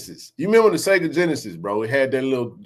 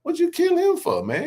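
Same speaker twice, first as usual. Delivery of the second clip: outraged neighbor; 13 semitones higher than usual. Retold or imitated.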